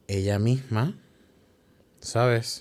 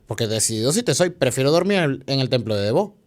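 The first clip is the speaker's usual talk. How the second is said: ordinary delivery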